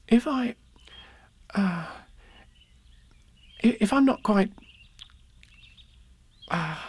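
put-on high boy's voice, faltering with long pauses; halting earnest attempt. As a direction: struggle to get it out as a child. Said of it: "he stopped and tried again".

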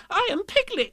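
very high squeaky protest; tiny indignant animal voice; insistent Piglet. Acted. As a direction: squeak out who you are. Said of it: "said Piglet loudly".

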